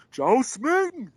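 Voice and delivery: high, loud imitated shout across the office; loud yell. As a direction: yell the name out in greeting. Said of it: "I just get a loud".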